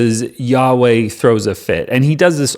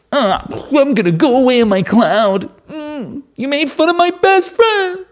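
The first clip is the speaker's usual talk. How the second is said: high whiny mock pout; mock sulky God; 13 semitones higher than usual. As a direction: storm off like an offended child. Mocking God storming off.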